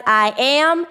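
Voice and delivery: "I am" pressed firmly; emphasized I am. Shown as a demonstration. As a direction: put weight on "I am". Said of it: "you hear me emphasize".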